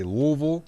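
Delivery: Louisville as "Luhvul", hit loud; Louisville with the middle swallowed; local Louisville pronunciation. Shown as a demonstration. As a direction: punch a squashed two-syllable Louisville. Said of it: "You have to say Louisville".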